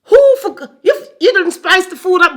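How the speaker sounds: high, loud mock Jamaican scolding; playful Jamaican-accented telling-off. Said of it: "I didn't go into my Jamaican accent".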